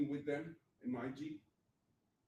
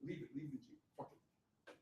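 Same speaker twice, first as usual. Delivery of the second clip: 轻声劝自己放弃，最后几乎是耳语; soft, giving-up voice trailing into a whisper; quiet self-talk; 9 dB quieter than usual. Retold or imitated. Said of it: "And I said".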